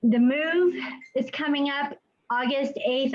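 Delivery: ordinary delivery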